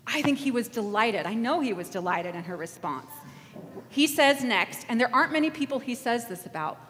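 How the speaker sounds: ordinary delivery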